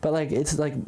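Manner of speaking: ordinary talk, nothing marked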